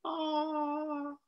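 high soft breathy aw; lightly voiced tender aw; awed sigh. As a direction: let the sky sigh at you in wonder. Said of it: "Scorpio is just like".

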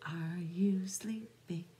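ordinary delivery, nothing marked

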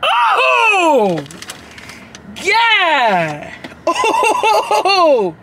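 high startled cry; shout. Real, not acted. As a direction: yelp at the burn. First taste reaction.

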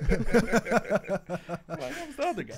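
high squealing laugh through words. Retold or imitated. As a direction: laugh while quoting.

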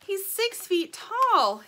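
small bird stretching out a big claim; little bird voice. Acted. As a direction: draw it out like a tiny worried bird. Little bird, slow and loud.

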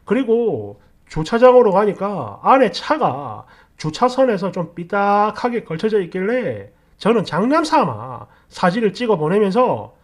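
plain reading voice

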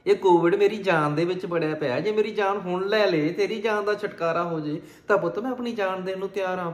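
put-on rally a frightened child to fight; acted fervent, defiant mother; impassioned reassurance. Mother defiantly rallies her sick son.

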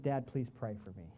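a son with a broken leg asking his dad to pray; weak child's plea. Retold or imitated. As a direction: ask quietly for prayer, in pain. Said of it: "He said".

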